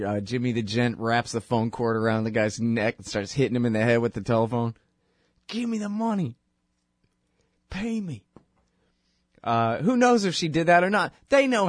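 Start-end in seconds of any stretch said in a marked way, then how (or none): 5.5-8.2 high, menacing mobster threat; mock gangster demand. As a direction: lean in like Goodfellas shaking someone down. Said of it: "like in Goodfellas".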